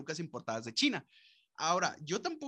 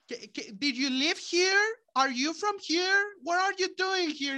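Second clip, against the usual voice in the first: much higher and louder, a pushy suburban lady; 13 semitones higher, 7 dB louder, slower than usual. Acted. Suburban woman stops a man walking his dog, high, loud and nosy.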